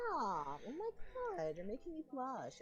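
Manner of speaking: flustered, pitch jumping up mid-protest; voice cracking. Real, not acted. Streamer blushing at compliments, flustered cracking voice. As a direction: squirm under praise as the voice cracks. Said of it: "you're making my voice crack".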